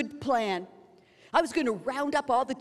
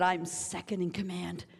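lower, softer, smug mock arrogance; arrogant boast; 8 semitones lower, 8 dB quieter than usual. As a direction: play the proud commander, low and quiet. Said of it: "with a look of arrogance".

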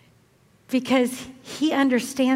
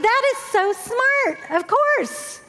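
delighted admiration; 8 semitones higher than usual. Retold or imitated. Grandmother retelling her reaction to granddaughter's question, delighted.

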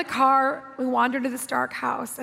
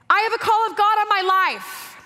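excited declaration; 8 semitones higher, 7 dB louder than usual. Retold or imitated. Teen declares calling to friends.

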